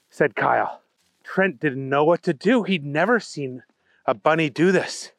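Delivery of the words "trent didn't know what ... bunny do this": ordinary delivery, nothing marked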